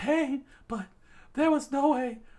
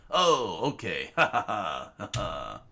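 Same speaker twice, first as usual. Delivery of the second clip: much lower, hammed-up ha ha ha; deep fake laugh; 4 dB quieter, slower than usual. Rio laughing off the misunderstanding, low hammed laugh. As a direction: laugh low and slow, overdone.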